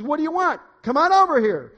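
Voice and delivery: high, loud calling across a store; shouted summons. Acted. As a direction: holler it high, as in a joke's dialogue. Man and parrot calling across store.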